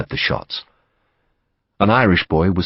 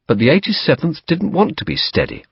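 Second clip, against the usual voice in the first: ordinary delivery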